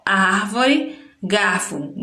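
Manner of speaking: "árvore" drawn out slowly with a rising end; patient model word; slow teaching voice. Shown as a demonstration. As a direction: spell out "árvore" slowly for children. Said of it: "a gente tem ar".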